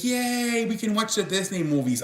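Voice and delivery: high, bubbly mock cheer from the sister; excited sister. Sister cheering about Disney movies, imitated with high excitement. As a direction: squeal the yay like a thrilled sister. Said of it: "So she's like".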